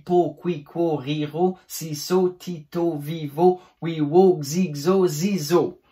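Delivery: sing-song paired syllables, evenly timed; articulation drill. Shown as a demonstration. Teacher reversing the vowel order, rhythmic syllable drill. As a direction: bounce through each pair distinctly. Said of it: "si je fais le Y avant le O".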